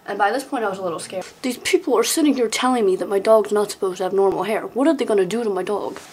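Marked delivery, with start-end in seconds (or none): 1.4-6.0 fast, louder panicked rant; panicked rush. Acted. Kid retelling scary girls to mom, fast panicked rant.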